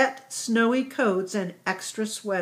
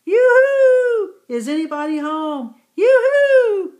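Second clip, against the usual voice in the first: high, loud calling out yoo-hoo; yelled call; 12 semitones higher, 9 dB louder than usual. A narrator's reading. Yalta Yak calling out to a house in the snow, loud high yell. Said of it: "Yalta Yak yelled".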